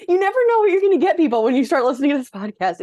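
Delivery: podcast host bursting out to the audience, excited and fast; excited announcement. Acted. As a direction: hype it up like a show host. Calling out to the listeners.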